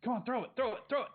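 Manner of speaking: put-on high, loud pleading little boy; impatient kid. Little brother begging Noah to throw, impatient. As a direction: hurry him up, bouncing. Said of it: "And he goes".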